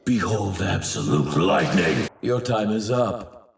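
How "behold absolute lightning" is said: ordinary delivery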